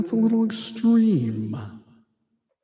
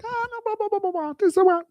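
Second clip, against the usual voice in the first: squeaky, much higher blah blah; playful falsetto babble; mock high voice; faster than usual. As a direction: imitate yourself gushing in a silly high pitch. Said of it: "I'll be like".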